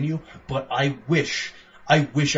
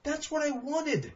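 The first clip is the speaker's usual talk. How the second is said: much higher, giddy cheer; thrilled; 14 semitones higher, 3 dB quieter, much slower than usual. Retold or imitated. Recalling the cop fight to his co-host, high excited cheer. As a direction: squeal it like finally getting what you wanted. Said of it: "I'm like".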